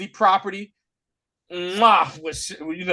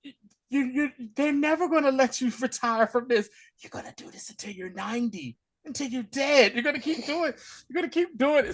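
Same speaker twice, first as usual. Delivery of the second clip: higher, playful Deadpool teasing; mock Deadpool teasing; 7 semitones higher, 3 dB quieter, faster than usual. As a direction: rib him about never retiring. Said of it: "He was like".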